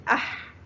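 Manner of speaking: "أح" trailing into vowelless pharyngeal friction; sukun on haa. Shown as a demonstration. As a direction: say "أح" ending on a throaty, voiceless h. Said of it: "حرف الحاء فوقه سكون ينطق". (نطق "أح" بحاء ساكنة)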